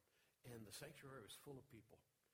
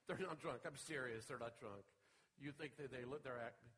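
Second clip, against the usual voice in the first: urgent mock reassurance; 9 dB louder than usual. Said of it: "we went".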